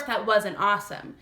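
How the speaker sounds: usual speaking voice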